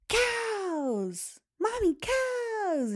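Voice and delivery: high, drawn-out excited yell; imitated child's scream. Daughter spots cows from the car, mimicked screaming. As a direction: scream it like a thrilled kid, stretching the word. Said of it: "and she'd scream it".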